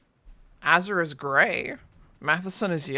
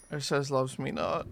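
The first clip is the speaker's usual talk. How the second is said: gloomy low mutter; 4 semitones lower, 5 dB quieter than usual. Pretend. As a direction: lower and softer, glum. Petal game alternating voices.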